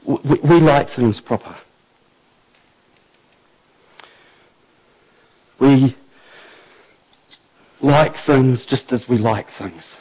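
low, soft, intimate sermon voice; quiet earnest preaching. Acted. Preacher challenging the church about proper praise, low and hushed. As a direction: draw the congregation in, hushed and sincere.

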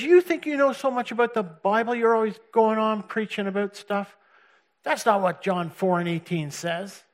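mock the furious caller; lightly voiced angry man; imitating angry Ken. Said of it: "he's angry as".

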